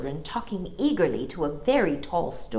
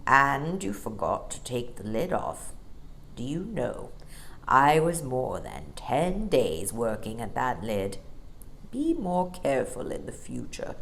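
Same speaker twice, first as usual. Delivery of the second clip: deep bear voice; 4 semitones lower than usual. A narrator's reading. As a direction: rumble it like a big old bear. Bear's voice in story.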